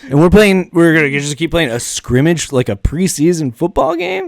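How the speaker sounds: high, loud, indignant question; retold outrage rising to a question; incredulous. Author objecting to a scrimmage going on, incredulous. Retells disbelief at game resuming.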